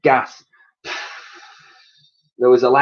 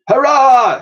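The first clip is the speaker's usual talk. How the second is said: high loud cheer; shout; 14 semitones higher, 8 dB louder than usual. Acted. Crew on board the Victory cheering, loud shout. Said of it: "shouted everyone on".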